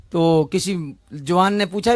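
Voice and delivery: ordinary delivery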